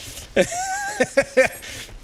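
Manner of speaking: very high wheezy giggle; high-pitched laugh. Real, not acted. Streamer amused by the idea of viewers in Discord, high laugh. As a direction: burst into a high squeal of laughter.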